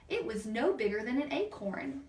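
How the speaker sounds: usual speaking voice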